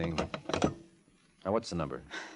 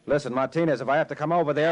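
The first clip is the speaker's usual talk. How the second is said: louder, clipped warning over the phone; threatening; 6 semitones higher, 4 dB louder than usual. Acted. Detective warns Martinez on the phone, threatening. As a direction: lean on him, higher and louder.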